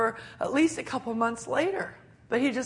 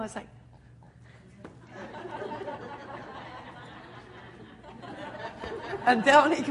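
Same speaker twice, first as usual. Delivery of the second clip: ordinary delivery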